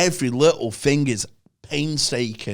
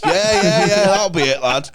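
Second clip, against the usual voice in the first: sarcastic reply; 5 semitones higher, 5 dB louder than usual. Retold or imitated. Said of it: "sarcastic reply".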